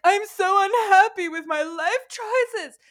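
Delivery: high, loud, slow self-pitying moan; mock wail. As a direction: ham up the despair. Moaning to mom on couch.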